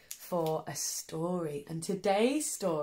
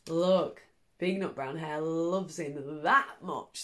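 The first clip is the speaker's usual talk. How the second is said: plain reading voice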